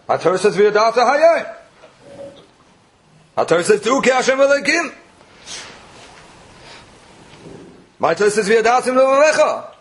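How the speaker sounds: high, rising declamation of scripture; emphatic proclaiming. Retold or imitated. Speaker answering the true believer from his Torah, emphatic proclaiming. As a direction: proclaim each quote with pitch climbing high.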